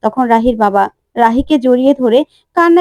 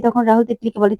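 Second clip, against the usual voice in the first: ordinary delivery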